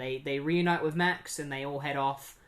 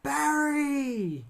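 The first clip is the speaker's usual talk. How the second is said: high, long-drawn call; desperate cry; 11 semitones higher than usual. Retold or imitated. Wally calls after Barry who leaves, desperate cry. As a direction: cry out after someone walking away.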